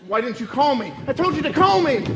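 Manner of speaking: upset. Acted. Felix blaming Oscar for the ruined dinner, loud upset. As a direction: scold him for not calling.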